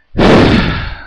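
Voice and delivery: loud weary exhale; a big, exhausted sigh; heavy sigh. Real, not acted. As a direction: huff out all the frustration. Fed up with the economy.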